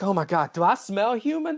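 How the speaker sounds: high, appalled; mock horrified whine. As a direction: sniff yourself in horror. Spock horrified by his smell.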